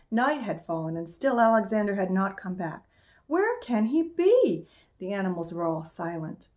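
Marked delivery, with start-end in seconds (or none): 3.3-4.7 high, drawn-out anxious question; a touch of worry, pitched up and slow; worried. A narrator's reading. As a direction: wonder anxiously, stretching the words. Old lady pacing, waiting for Alexander.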